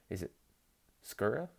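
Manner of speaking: ordinary talk, nothing marked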